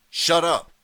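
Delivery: high, loud angry snap; snapped. A narrator's reading. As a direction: cut her off sharply. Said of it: "Artie snapped".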